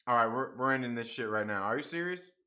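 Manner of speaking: normal talking voice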